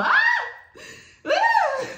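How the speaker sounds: high, loud comic scream; re-enacted freak-out scream. Woman acting out her moth panic, screaming. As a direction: shriek as if flailing at a moth. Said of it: "And I started going crazy".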